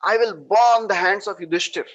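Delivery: loud, hot-tempered command; furious order. Retold or imitated. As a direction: Bhima demanding fire to burn the king's hands. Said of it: "He becomes so very angry".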